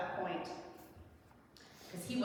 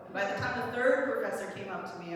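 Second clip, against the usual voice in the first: ordinary delivery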